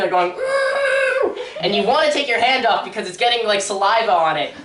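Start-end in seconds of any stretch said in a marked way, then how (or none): 0.3-1.4 high scream through a covered mouth; muffled scream. Retold or imitated. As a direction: imitate screaming under someone's hand. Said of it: "cover their mouth".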